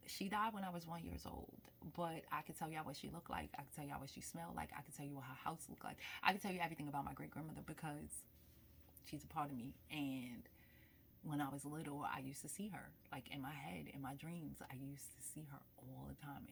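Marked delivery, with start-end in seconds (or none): 3.6-6.0 fast hushed whisper. Real, not acted. Telling viewers about great-grandmother, in a whisper. 13.1-16.5 much softer, breathless whisper. Real, not acted. Recalling great-grandmother's visits, in a whisper. Whispered memory of seeing her.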